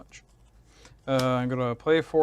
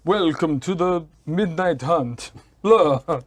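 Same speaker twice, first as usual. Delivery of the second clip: vampire voice; 4 semitones higher, 7 dB louder than usual. Pretend. Vampire interjection, loud.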